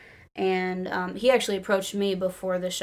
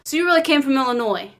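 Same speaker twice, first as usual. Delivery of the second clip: quick, higher imitation of a shy wrestler; lightly voiced Colin, quick and higher; impersonating Colin; 6 semitones higher, 4 dB louder, faster than usual. Fan retells Colin asking about her trip, quick and higher. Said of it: "terrible job impersonating him".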